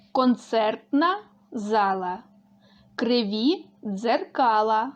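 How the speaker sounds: each phrase spoken slowly, word by word; slow it down so pupils can repeat. Shown as a demonstration. Teacher slowly reading phrases for children to repeat. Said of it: "Повторюємо за мною".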